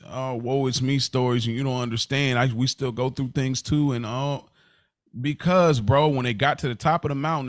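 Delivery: ordinary talk, nothing marked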